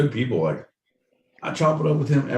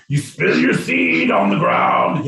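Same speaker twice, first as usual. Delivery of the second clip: slow, high, loud proclamation; booming mock-biblical decree; mock scripture shout; 5 semitones higher, 4 dB louder, slower than usual. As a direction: thunder it slowly like a mock warning.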